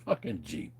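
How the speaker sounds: ordinary talk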